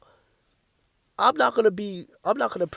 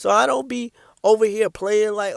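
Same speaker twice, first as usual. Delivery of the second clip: ordinary talk, nothing marked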